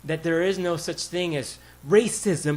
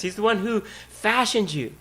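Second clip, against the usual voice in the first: ordinary talk, nothing marked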